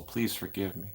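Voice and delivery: ordinary delivery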